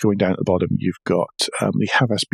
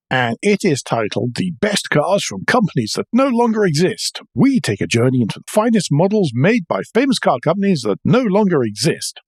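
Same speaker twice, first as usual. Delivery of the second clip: higher-pitched, swooping vintage newsreel announcer; old-time newsreel narrator, pitched up with big rises and falls; Pathé News voice; 6 semitones higher than usual. Acted. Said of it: "in my finest, um, Pathé News voice".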